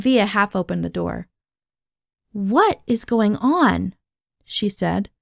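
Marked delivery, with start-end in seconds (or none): 2.3-3.9 slow, drawn-out, stunned question; incredulous and emphatic; bewildered demand. Acted. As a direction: stretch each word in disbelief. Stunned friend demands an explanation.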